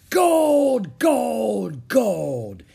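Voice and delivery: drawn-out ecstatic bellow, stepping down in pitch; crying out. A narrator's reading. Captain Bones catching the medallion, rapturous cry. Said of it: "he cried".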